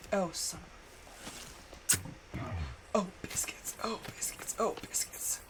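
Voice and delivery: hushed despairing whisper; dismayed whisper. Real, not acted. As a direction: breathe it out in defeat. Whispered dismay at game setback.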